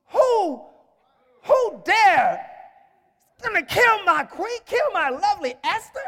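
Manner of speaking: high, loud disbelief; outraged king. Acted. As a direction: act a king appalled for his queen. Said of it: "He was so mad".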